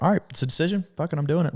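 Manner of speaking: half-sung resolve to just do it; resolved self-quote. Retold or imitated. Himself deciding to forgive his stepmother, high light voice.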